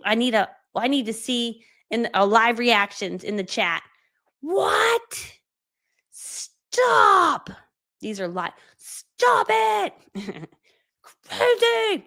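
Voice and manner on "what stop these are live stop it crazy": mock shock. Host squealing in mock shock at her own price. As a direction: shriek like she can't believe it.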